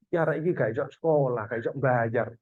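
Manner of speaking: quick, quiet, mumbled plea; quoted complainer. Retold or imitated. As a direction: mutter it like someone asking for help. People asking for donations. (permintaan lirih dan cepat)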